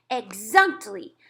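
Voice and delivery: loud, slow triumphant cry; cried out. Acted. Giant agreeing with Sophie, loud slow cry. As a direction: stretch it out in triumph. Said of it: "cried the B F G".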